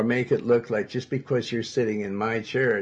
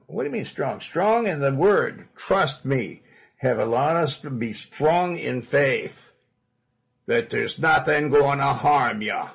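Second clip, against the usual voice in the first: forceful preaching; 4 semitones higher, 5 dB louder than usual. Real, not acted.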